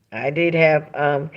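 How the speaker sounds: ordinary talk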